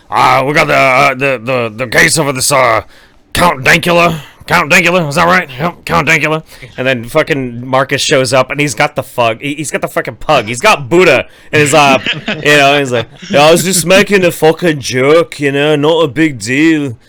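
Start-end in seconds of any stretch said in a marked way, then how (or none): 0.1-6.4 loud, halting impression of a show host; Alex Jones impression. Imitating InfoWars host introducing Count Dankula, loud and fumbling. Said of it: "never forget Alex Jones being like". 13.3-17.0 loud put-on Scottish accent. Imitating Marcus on InfoWars, loud Scottish accent. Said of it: "worse than your Scottish accent".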